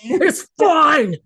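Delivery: high, loud mock shout; mock insistence. Friends joke about resisting change, mock insistent shout. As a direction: belt it out as a joke. Joking echo of "it's fine.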